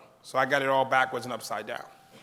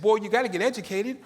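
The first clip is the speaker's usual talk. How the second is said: higher, louder mom scolding; a mother telling her son he must get educated, higher and louder; firm mother; 6 semitones higher, 4 dB louder than usual. Retold or imitated. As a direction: set your boy straight.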